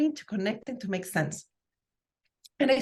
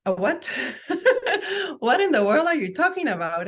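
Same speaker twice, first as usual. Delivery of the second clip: laughing, incredulous blurt, pitched up; baffled reaction; 6 semitones higher, 3 dB louder than usual. Retold or imitated. Coach recalling her reaction to the strange advice, baffled and laughing. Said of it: "And I was like".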